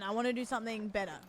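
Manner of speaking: ordinary talk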